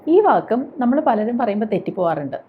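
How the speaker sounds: normal talking voice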